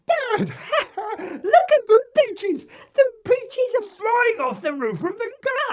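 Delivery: put-on high, loud excited kid's call with a laugh; child calling out. A narrator's reading. Joe calling his dad about the flying peaches, high and excited. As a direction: shout it up, delighted by the chaos. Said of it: "So Joe is calling out".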